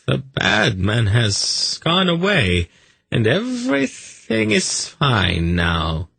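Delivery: put-on slow, high baby-talk comfort; soothing. A narrator's reading. Zadi calming the snake in the bottle, soothing. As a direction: soothe a sulking pet, drawn out. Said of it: "he said soothingly".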